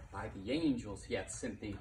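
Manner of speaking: usual speaking voice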